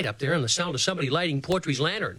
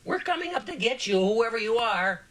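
yelling up to a window; 6 semitones higher than usual. A narrator's reading. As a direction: call up high to someone hidden above, drawing it out. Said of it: "Portrait yelled up to the window".

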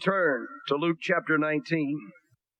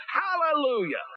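ringing hallelujah; 10 semitones higher than usual. Acted. Scripture shout of multitude.

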